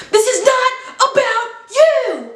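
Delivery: high, slow furious shout. Retold or imitated. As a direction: yell it at him, slowly. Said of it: "she goes".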